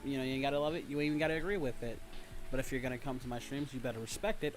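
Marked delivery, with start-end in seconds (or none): none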